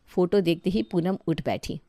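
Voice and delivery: ordinary delivery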